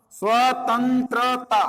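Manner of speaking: "स्वतंत्रता" drawn out part by part; slow word demo. Shown as a demonstration. Teacher presenting a hard word to students, "स्वतंत्रता" said slowly. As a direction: stretch "स्वतंत्रता" slowly over its syllables. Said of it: "स्वतंत्रता शब्द बना कैसे है".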